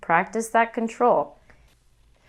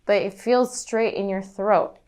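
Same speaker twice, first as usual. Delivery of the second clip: usual speaking voice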